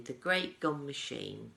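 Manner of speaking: ordinary delivery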